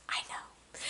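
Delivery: barely voiced, hushed reply; whispered admission. Answering friend looking at the blood, tiny whisper. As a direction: whisper it after a pause, imitating your stunned self.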